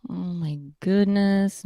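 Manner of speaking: normal talking voice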